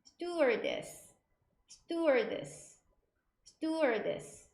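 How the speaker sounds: "stewardess" very slow, falling at the end; repeat-after-me demo. Shown as a demonstration. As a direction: model "stewardess" deliberately for students. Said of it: "세 번 해볼까요?". ("stewardess"를 따라 하도록 천천히)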